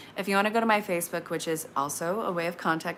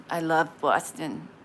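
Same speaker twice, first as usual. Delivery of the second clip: slow, drawn-out Boston accent on "I love Boston"; 3 semitones lower than usual. Shown as a demonstration. Reader greeting a Boston viewer, in a Boston accent. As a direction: slip into a Boston accent for the word Boston. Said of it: "And I will say it like that every time".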